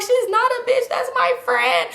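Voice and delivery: mock tearful, very high-pitched wail; crying protest. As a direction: whine it out like a drunken crybaby. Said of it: "I'm just, like, crying now".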